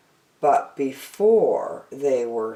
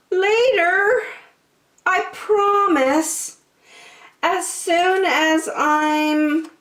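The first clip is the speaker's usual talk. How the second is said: put-on much higher child voice, drawn-out promise; pleading little girl; 14 semitones higher, 3 dB louder, slower than usual. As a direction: beg for a bit more time. Jillian pleads again.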